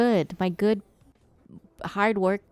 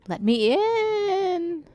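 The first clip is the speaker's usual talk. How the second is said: high, very slow fake-crying whine; hammed-up sob; mock wailing plea; 10 semitones higher, much slower than usual. Streamer begging in game to be let in, mock wailing.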